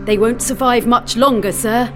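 urgent plea. A narrator's reading. Officer pleading for drowning men, urgent. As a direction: plead with the captain.

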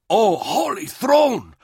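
slow, high, shocked exclamation; shocked gasp. A narrator's reading. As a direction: gasp it out in horror. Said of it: "Dower gasped".

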